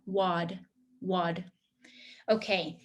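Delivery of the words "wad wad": wad ending in a buzzing D; voiced D. Shown as a demonstration. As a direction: make the D in wad vibrate. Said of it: "feel a little bit of a vibration here".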